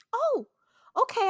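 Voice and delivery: high, surprised oh okay; a clerk startled by the customer's refusal, mildly surprised; mock surprised. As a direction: act caught off guard, politely. Taken aback by customer's refusal.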